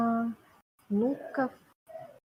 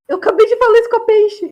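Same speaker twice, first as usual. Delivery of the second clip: excited shout; 11 semitones higher, 10 dB louder than usual. Real, not acted. Excited outburst over a coincidence.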